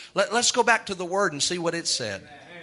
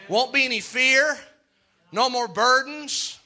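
raised, slow, rolling preacher's cadence; preaching litany; 7 semitones higher than usual. Acted. Preacher listing what heaven ends, chanting. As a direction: build each no more like a chant.

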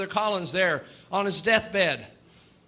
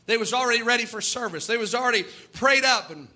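usual speaking voice

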